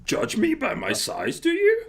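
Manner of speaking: slow, high-pitched growly creature voice; Yoda. Acted. Host proving he can do voices, growly little sage. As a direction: growl it slowly, pitched well up. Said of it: "you had the growl in there".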